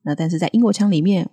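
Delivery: ordinary talk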